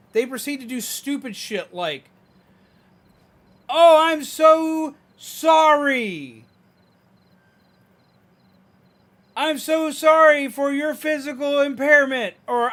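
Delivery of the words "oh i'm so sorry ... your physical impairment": high, loud, slow mock sympathy; overdone condescending sorry, imitating strangers; loud obnoxious pity. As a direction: drag out the fake pity, loud and syrupy. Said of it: "they talk like that. It's loud and obnoxiously done".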